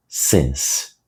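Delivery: "Since" short, low and soft; correct pronunciation. Shown as a demonstration. Teacher reminding students how since is pronounced. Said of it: "lembre-se da pronúncia dele".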